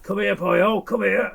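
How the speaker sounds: high-pitched hail from a stranger; imitated calling out. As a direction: call out as the Welshman running over. Retelling stranger running at him calling.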